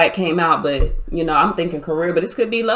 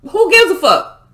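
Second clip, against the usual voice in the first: much higher, louder indignant shout; fired-up outburst; 9 semitones higher, 4 dB louder than usual. Real, not acted. Tarot reader ranting at judgmental people, loud and high. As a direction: throw it at the doubters, then ease a bit.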